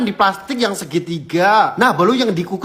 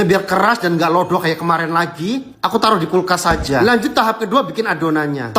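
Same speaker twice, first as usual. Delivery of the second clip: normal talking voice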